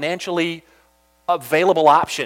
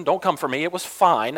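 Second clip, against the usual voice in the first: ordinary talk, nothing marked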